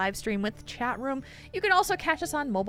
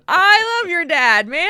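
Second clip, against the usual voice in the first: ordinary talk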